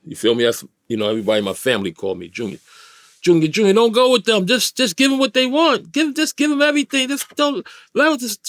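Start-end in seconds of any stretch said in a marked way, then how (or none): 3.2-8.5 high-pitched pleading, as his panicked aunt; mock panicked cry, pitched way up; imitating panicked yelling. Aunt begging her nephew to give them everything, panicked yelling. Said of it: "yelling and screaming and shit".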